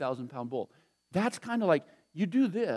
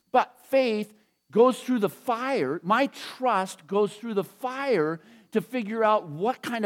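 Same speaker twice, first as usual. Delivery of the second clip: slow, weighty sermon build; slow preaching emphasis; 3 semitones higher, slower than usual. Acted. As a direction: draw each phrase out for the congregation. Preaching climax on faith's fire.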